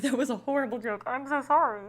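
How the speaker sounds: high, loud, laughing mortification; embarrassed, laughing outburst; laughing apology. Real, not acted. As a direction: apologize loudly through laughter. Laughing apology for own bad joke.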